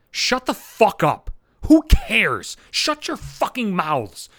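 drawn-out, disgusted telling-off; slow angry dismissal. Real, not acted. Ranting comic slowly telling whiners to shut up. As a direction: spit each word out slowly at them.

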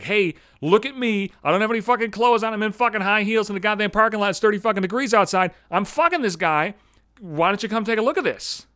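brazen woman imitation. Naked woman shows off to men in parking lot, brazenly.

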